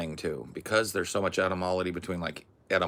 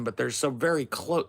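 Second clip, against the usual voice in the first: normal talking voice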